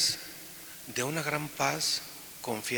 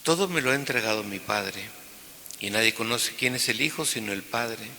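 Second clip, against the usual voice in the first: proclaimed Gospel reading; 3 semitones lower than usual. Acted. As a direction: proclaim it to the whole church. Proclama el Evangelio en misa. (lectura solemne del Evangelio)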